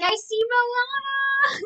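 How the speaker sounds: very high, loud, stretched squeal; mock fangirl shriek, drawn out; imitated excited cry. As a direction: squeal it like a thrilled fan. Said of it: "Amon is like".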